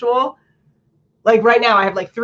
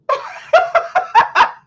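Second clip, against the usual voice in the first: high laugh. Real, not acted. High laugh at her own mock of filler words.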